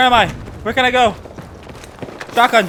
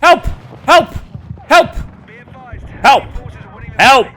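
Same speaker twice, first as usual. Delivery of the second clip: calling for help; 5 semitones higher, 4 dB louder than usual. Real, not acted. Repeated calls for help in game.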